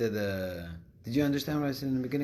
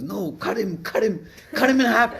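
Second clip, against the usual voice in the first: higher, louder, laughing through the punchline; mock mother-in-law yell; 9 semitones higher, 5 dB louder than usual. Teller voicing the Tunisian mother-in-law, laughing loud mock yell. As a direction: yell it gleefully while cracking up. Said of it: "The Tunisian goes".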